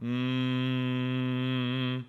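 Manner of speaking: long steady soft hum; sustained gentle "mm"; humming. Shown as a demonstration. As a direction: hum softly on one held note. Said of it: "is humming".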